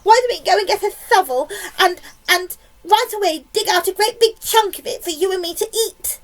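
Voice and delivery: high, loud, greedy enthusiasm; eager cry. A narrator's reading. Aunt urges sister to dig in, eager excited cry. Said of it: "she cried".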